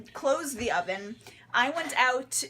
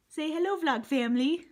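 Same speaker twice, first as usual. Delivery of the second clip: high, louder pet voice; cheerful baby talk for the dog; 6 semitones higher, 4 dB louder than usual. Pretend. Speaking for the dog.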